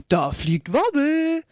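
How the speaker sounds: high, slow, drawn-out exclamation; chorus cry. A narrator's reading. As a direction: call it out slowly as a whole crowd would. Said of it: "hörte sie einen allgemeinen Chor". (langgezogener Ausruf im Chor)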